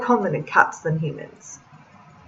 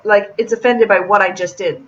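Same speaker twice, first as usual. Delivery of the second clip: normal talking voice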